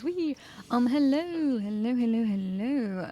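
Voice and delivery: streamer voice. Acted. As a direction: switch on the cheery stream host. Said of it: "Turning on the streamer voice".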